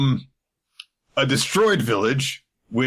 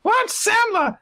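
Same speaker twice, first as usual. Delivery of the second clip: very high squeaky confused question; very high puzzled kid voice; puzzled child; 3 dB louder than usual. Retold or imitated. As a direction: shoot the pitch sky high, baffled. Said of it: "they're like".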